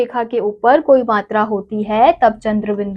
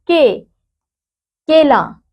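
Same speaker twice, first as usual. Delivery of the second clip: "के" and "केला" lifted higher, each word clearly marked; teacher emphasis; 4 semitones higher, slower than usual. Shown as a demonstration. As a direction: announce "के, केला" brightly to the class. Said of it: "ए की मात्रा क जमा ए".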